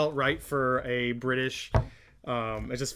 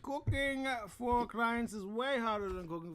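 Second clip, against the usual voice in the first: punchy teaser announcer read; trailer voiceover; 6 semitones higher, 9 dB louder than usual. Acted. Both hosts reading the book's blurb as a trailer voiceover. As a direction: sell it like a TV promo.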